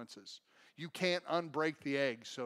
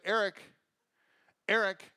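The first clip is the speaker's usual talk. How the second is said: lightly voiced mom calling out; 2 semitones higher, 3 dB louder than usual. Mom turns around looking for her son, mild calling. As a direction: call the child's name, first try. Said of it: "she's like".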